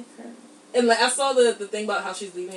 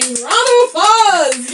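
loud, high, drawn-out singsong; celebratory cheer; 9 semitones higher, 15 dB louder, slower than usual. Real, not acted. Podcaster applauds listeners still there, loud singsong cheer. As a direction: cheer loudly, stretching the words.

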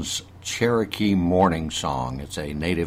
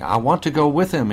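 high pleading whine; whining dog; 8 semitones higher, 5 dB louder than usual. A narrator's reading. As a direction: beg to go along, high and small. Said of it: "he whined".

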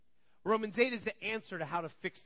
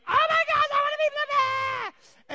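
very high, loud, slow mock wail; freaking-out child; 11 dB louder, slower than usual. Griffin freaking out over the blue piece, screaming wail. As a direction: imitate a kid's full-volume meltdown. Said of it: "Griffin's freaking out".

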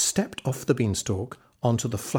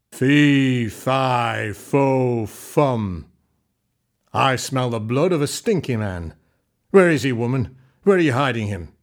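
loud booming giant's voice; menacing giant; 6 dB louder than usual. A narrator's reading. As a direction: boom it out like a hungry giant. Giant arrives sniffing.

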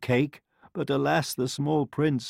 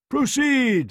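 high, loud mock yawn; yawned royal command; 14 semitones higher, 5 dB louder than usual. A narrator's reading. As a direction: stretch it through a royal yawn. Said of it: "the king yawned".

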